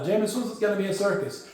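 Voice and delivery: ordinary talk, nothing marked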